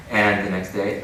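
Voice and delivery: normal talking voice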